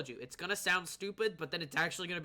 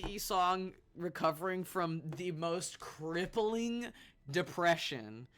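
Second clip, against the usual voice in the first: usual speaking voice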